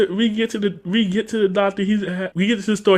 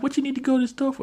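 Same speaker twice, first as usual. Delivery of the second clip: quick, high-pitched imitated questioning; mock puzzled uncle; 9 semitones higher, 4 dB quieter, faster than usual. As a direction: quiz her fast in a raised pitch. Voices uncle questioning niece.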